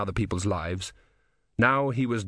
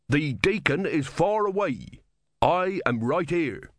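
higher, slow, weighted words; commanding sergeant; 6 semitones higher, slower than usual. Acted. Sergeant overruling the corporal, slow pointed command. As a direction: spell out authority slowly and firmly.

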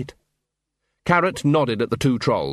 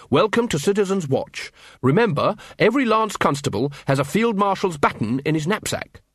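higher-pitched welcome speech; keen officer, welcoming; 7 semitones higher than usual. Acted. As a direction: welcome recruits like an eager corporal. Carrot welcomes the recruits.

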